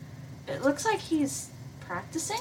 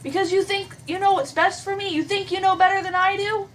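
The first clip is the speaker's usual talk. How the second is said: higher, hurt and indignant; indignant Hannah; 8 semitones higher, 4 dB louder than usual. Acted. Hannah snaps back at PBG, hurt and indignant. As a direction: throw it back at him, higher.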